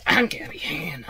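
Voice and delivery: whispered self-introduction; breathy whisper. Acted. Guest introducing her own single to the interviewer, whispered. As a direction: whisper your name like a song intro.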